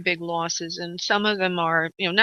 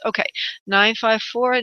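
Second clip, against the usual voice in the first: ordinary delivery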